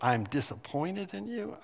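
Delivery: rising, half-disbelieving repeat; incredulous echo. Retold or imitated. Boy echoing his dad's words, lightly incredulous. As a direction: toss the words back as a question. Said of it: "I just said".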